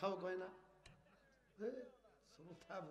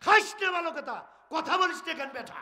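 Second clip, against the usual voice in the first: high, loud scolding; রাগে ধমকানো; angry rebuke; 9 semitones higher, 6 dB louder than usual. Acted. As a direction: berate the idols. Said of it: "তাহলে মেজাজ খারাপ".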